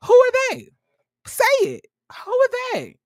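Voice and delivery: over-the-top high-pitched "who are they?"; shrill demand. Retold or imitated. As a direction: demand the answer in a mock squeal. Acting the confrontation, demanding answer.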